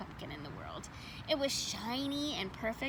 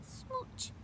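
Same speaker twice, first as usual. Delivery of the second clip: kiss; 13 semitones higher, 12 dB quieter than usual. Acted. Kiss sound.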